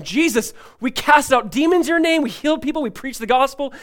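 thrilled report, voice jumping up; excited disciples. Retold or imitated. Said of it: "they're freaking out".

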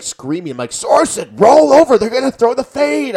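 high, loud shout at the screen; yelling at the TV. Retold or imitated. Fan shouting a warning at the TV during the game, loud and high. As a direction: bellow the warning at the player as if he could hear. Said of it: "I was like".

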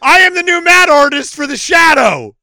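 loud, high victory cry; triumphant shout. Real, not acted. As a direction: announce it like you won. Celebrating his fake IMDB credit.

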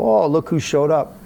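a sarcastic "look who finally showed up"; sarcastic greeting. Retold or imitated. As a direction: needle the late doctor. Sarcastic jab at late doctor.

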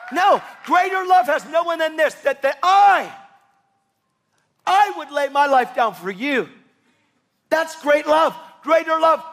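high, loud preacher's cry; passionate shouting. Real, not acted. Preacher shouting to the congregation about God's acceptance, full-throated. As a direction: proclaim it with all your force.

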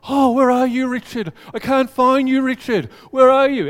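high, loud playful seeking voice; hammed-up big brother pretending not to see; teasing older brother. Brother Case voiced in story.

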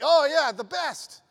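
loud, high, strained "oh yeah"; forced enthusiasm. Retold or imitated. Boy lying to his friend with loud fake cheer. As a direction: fake it loudly to hide the fear. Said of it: "And I'm like".

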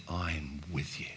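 God whispering reassurance; hushed slow promise. Shown as a demonstration. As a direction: say it slowly and tenderly.

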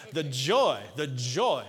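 joyful. Shown as a demonstration. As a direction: say joy with a smile. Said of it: "you better smile when you say joy now".

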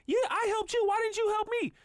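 put-on very high, loud squabbling-kid voice; passionately arguing child. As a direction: mimic a kid in a heated sibling row. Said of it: "they arguing so passionately about this".

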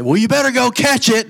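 high-pitched prank-call payoff; prank punchline. Retold or imitated. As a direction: blurt it out high, springing the joke.

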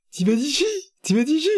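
high sing-song imitation of a guy; guy offering juice. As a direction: squeak it like a Vine character. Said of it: "ça m'a fait penser à un mec qui dit".

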